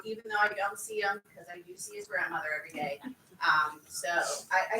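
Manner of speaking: ordinary talk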